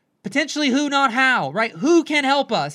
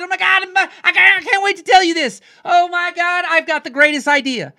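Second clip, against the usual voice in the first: gushing, high and loud; excited; 8 semitones higher, 8 dB louder than usual. Acted. Telling a friend a big new idea, bursting with excitement. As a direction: burst out with news you can't hold in. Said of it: "you were so excited".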